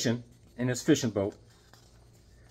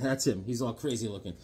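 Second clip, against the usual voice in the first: ordinary delivery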